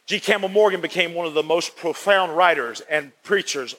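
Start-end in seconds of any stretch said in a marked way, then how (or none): none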